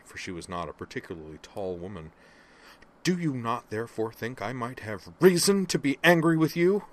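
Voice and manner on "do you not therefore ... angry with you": loud and pitched high, peaking on you; savage, loud rhetorical demand; furious snarl. Acted. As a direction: spit the question up into his face. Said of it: "she all but snarled up into his face".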